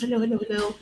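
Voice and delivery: normal talking voice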